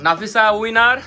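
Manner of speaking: usual speaking voice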